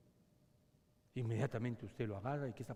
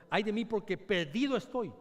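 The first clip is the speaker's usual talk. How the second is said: cried out; 7 semitones higher, 7 dB louder than usual. Acted. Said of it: "gritó".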